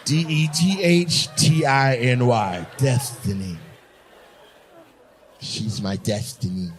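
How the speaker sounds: very low, slow mock lisp; imitating lisping man. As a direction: drop deep and draw out each lisped word. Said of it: "He said Destiny".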